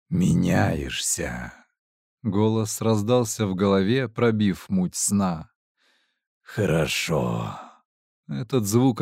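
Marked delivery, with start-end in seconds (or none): 0.1-1.5 slow, low, otherworldly voice; echoing ethereal energy being. A narrator's reading. Goddess voice in his head. 6.4-7.6 slow, low, otherworldly voice; echoing ethereal energy being. A narrator's reading. Goddess voice in his head.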